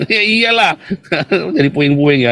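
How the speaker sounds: laughing while jeering at the boast; laughing mockery. Real, not acted. Mocks the boast about Gaza. (tertawa mengejek)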